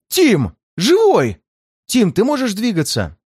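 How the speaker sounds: high joyful calling out; excited shout. Acted. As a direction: call out in relieved delight. Friend overjoyed he is alive. (радостный возглас)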